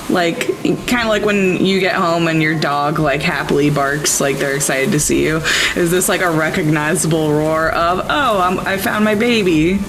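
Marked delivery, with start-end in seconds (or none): none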